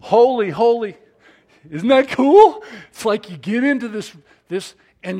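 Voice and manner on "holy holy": repeating it. Shown as a demonstration. Preacher urging the church, louder proclamation.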